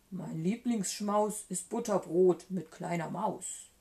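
storybook monster voice, changed timbre; Gruffalo monster. A narrator's reading. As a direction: put on a hungry monster's voice. Monster speaks, after "Der aber sprach.

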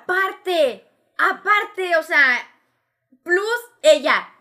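"Aparte" punched high and loud, repeated; high, loud insistence on "aparte"; emphatic addition. Real, not acted. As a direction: hit "plus ella" high and hard. Emphatically adds customer to the list.

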